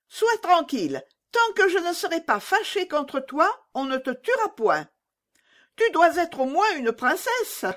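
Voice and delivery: put-on high child voice, gentler but bossy; calm her down while still in charge; robber girl reassuring. A narrator's reading. Robber girl holds Gerda by the waist, high half-gentle child voice. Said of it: "Elle prit Gerda par la taille et lui dit".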